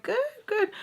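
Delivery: small, very high-pitched voice; polite high reply. Retold or imitated. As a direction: answer the mom in a tiny high voice. Polite answer to the mom.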